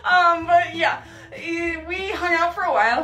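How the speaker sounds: embarrassed laugh to the parents; giggling while talking; laughing aside. Real, not acted.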